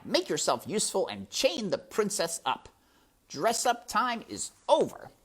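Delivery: high, loud, sneering rat; scornful rat villain. Acted. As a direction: belittle her, then order her about loudly.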